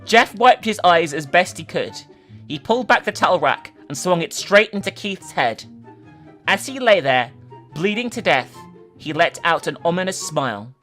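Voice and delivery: ordinary delivery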